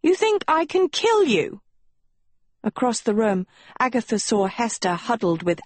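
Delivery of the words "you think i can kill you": very high wounded protest; hurt. A narrator's reading. Sophie protesting to Agatha that she is no killer, hurt. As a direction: pitch leaps up, then falls in injured disbelief. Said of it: "Sophie looked hurt".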